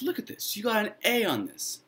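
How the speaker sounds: put-on high, breathy-start amazed girl voice; excited girl. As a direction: gasp at the essay then pitch it way up. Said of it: "And she says".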